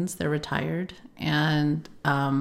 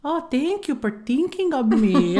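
higher, sweetly thankful mock dad; imitating dad; 7 semitones higher, much slower than usual. Daughter imitating her dad thanking her for calling, higher mock voice. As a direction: play the touched old dad thanking her. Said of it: "my dad's all".

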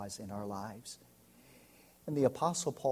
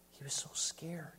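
hushed aside; 4 semitones higher, 5 dB quieter than usual. Real, not acted. Hushed remark.

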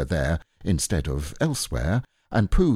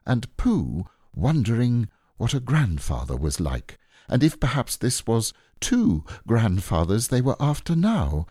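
usual speaking voice

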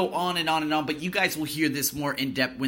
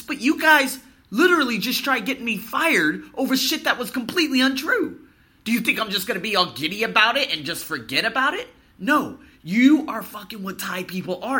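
angry; 6 semitones higher, 5 dB louder than usual. Real, not acted. Ranting at coworkers who betrayed him.

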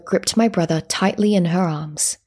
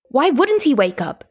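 raised, questioning interruption; interrupting; 7 semitones higher, 3 dB louder than usual. A narrator's reading. Child interrupts whispering villagers about her brother, raised questioning voice. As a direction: cut in with a higher-pitched question. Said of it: "I interrupted".

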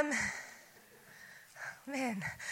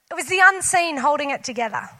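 ordinary talk

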